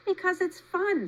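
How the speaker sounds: high, delighted possum voice; a little actress loving it; gleeful possum. A narrator's reading. Possum answers the robot, high and gleeful.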